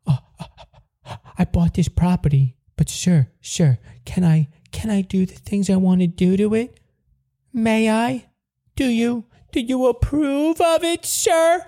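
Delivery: rising, simpering beggar voice; mock meek pleading. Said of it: "Fuck off".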